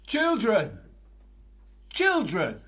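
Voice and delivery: calling out from far off, offstage; distant calling. Acted. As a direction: call the children from another room. Father calling from another room.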